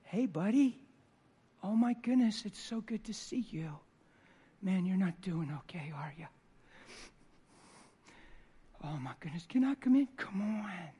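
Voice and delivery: soft, high greeting to a lost son; tender welcome. Retold or imitated. Father greets his returning son, soft and tender. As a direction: welcome him gently like a father. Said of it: "I looked out the door".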